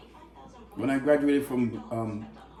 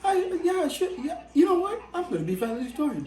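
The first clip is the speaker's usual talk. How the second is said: cocky younger self; 12 semitones higher than usual. Retold or imitated. To his friend, mocking his teen self with high cocky swagger. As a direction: puff up like the big man on campus, laughing.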